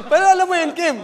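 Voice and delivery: loud, high-pitched call to a colleague. Real, not acted. Host checks whether a colleague knows the song, calling out loudly. Calls out to Kim mid-joke.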